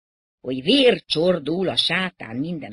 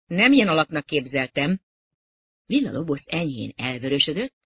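plain reading voice

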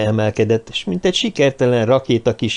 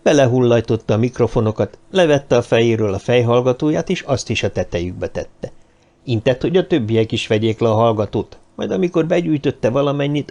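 usual speaking voice

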